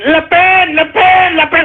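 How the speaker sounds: high-pitched mock chanting of a name; mocking cheerleaders. Mimicking right-wing idiots cheering Le Pen, mocking high chant. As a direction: chant it high, aping excited fans. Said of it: "all you idiots on the right wing".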